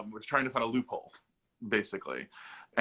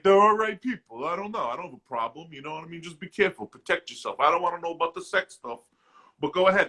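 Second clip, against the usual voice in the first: imitating dad; 5 semitones higher, 4 dB louder than usual. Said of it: "he was like".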